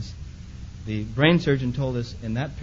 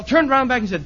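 ordinary talk, nothing marked

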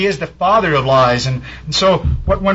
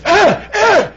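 high, loud panicked calling of a name; desperate call for help; frantic shout; 5 dB louder than usual. Retold or imitated. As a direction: yell down the phone like a father in terror. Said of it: "it was like this".

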